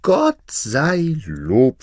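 slow, high, loud cry of joy; delighted wife. A narrator's reading. Wife rejoices over the new cow, loudly delighted. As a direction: draw out the thanks in delight. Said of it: "freute sich die Frau".

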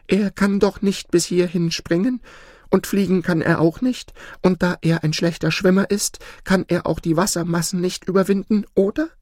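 high-pitched worried reasoning; anxious goose. Acted. Goose hoping man cannot reach the island, anxious. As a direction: talk yourself into safety, voice high, ending in a question. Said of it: "fragte die Gans ängstlich".

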